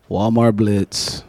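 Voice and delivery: slow, soft, deflated; reluctant sigh. Retold or imitated. Churchgoer dreading outreach, slow deflated mumble. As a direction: drag it out with no enthusiasm.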